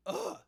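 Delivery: loud, high pained ugh; disgusted groan. Real, not acted. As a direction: groan at a terrible pun. Reacting to a cheesy pun.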